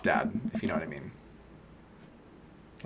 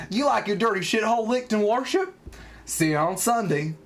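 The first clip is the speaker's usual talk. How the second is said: lightly mimicked cocky line, pitched up; imitating the clip; 9 semitones higher than usual. Mimicking a dating video for laughs, higher-pitched mock come-on.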